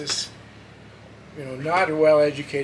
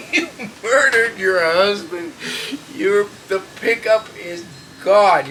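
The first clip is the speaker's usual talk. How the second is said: slow and louder, breaking into laughter; laughing through it; 5 semitones higher, 7 dB louder, slower than usual. Retold or imitated. Retelling his words to the widow, laughing and loud. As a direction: laugh while repeating the blunt accusation.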